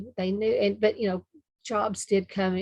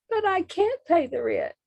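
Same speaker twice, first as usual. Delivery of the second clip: very high, pleading damsel cry; damsel in distress; 11 semitones higher, 3 dB louder than usual. Pretend. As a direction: wail it helplessly, higher. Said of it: "let's see if I can remember it".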